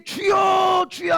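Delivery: high, loud, stretched-out call; imitated prayer cry. Preacher imitating believers who want wishes granted, crying out. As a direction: imitate a believer hollering for the Lord. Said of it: "하면서 도깨비 방망이를 찾아".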